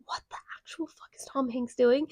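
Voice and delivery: hushed incredulous whisper; stunned by Tom Hanks, whispering; whispered disbelief. Retold or imitated. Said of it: "I was just like".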